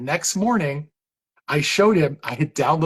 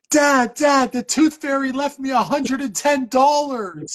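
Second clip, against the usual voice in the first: put-on kid yelling; 9 semitones higher than usual. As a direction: shout down the stairs like an excited little kid. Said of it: "you hear the little kid yelling".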